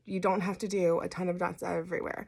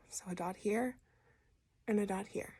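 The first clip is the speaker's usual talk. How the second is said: whispering; 8 dB quieter than usual. Real, not acted. Whispering while applying dots.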